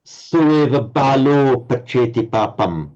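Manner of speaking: slow, careful Pali chant of the verse; Pali recitation. Shown as a demonstration. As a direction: recite each Pali syllable slowly. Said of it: "You have to say".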